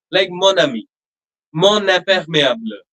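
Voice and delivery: mon ami with the N linked into ami; liaison on mon. Shown as a demonstration. Teacher to student, showing the linked N. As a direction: make the N of mon sound and join the next vowel. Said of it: "That N sound will become prominent".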